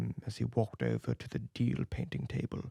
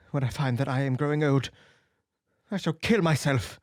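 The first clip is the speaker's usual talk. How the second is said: high, raised voice full of hurt reproach; bitter young Dorian; 4 semitones higher, 10 dB louder than usual. A narrator's reading. Dorian reproaching the painter, bitter and wounded. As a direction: lash out at the friend who painted you.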